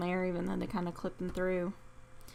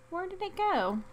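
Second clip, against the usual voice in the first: puzzled high question; 10 semitones higher than usual. Real, not acted. Item vanished.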